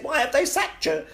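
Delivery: very high, loud anxious question; high alarmed housemate voice; worried flatmates. Retold or imitated. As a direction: fret like a panicky housemate.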